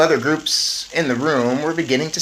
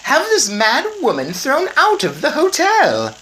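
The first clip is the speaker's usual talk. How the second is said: haughty Mrs Jenkins; 14 semitones higher, 6 dB louder than usual. Acted. Snobbish mother dismisses the old woman, high and loud. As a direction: look down your nose at her. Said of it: "Miss Jenkins said".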